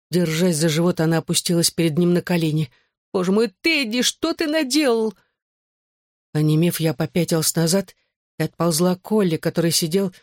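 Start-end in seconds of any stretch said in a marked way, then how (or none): 3.1-5.1 high, distressed wail; tearful lament. A narrator's reading. Mother kneels before her husband, high tearful lament. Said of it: "всхлипывала мама".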